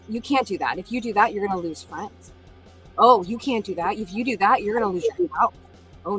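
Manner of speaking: ordinary talk, nothing marked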